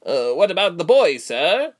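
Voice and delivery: high, loud, anxious underling; nervous messenger. A narrator's reading. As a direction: ask the master in a high, uneasy voice. Timid messenger asks his master.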